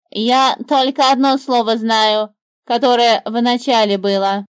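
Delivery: the bird explaining which word she knows. A narrator's reading. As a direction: declare like a proud bird. Bird's voice.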